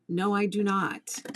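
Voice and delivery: ordinary delivery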